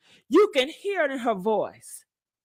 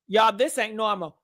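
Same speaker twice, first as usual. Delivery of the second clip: ordinary talk, nothing marked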